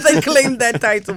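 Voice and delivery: laughing. Real, not acted. Guest laughs about parents.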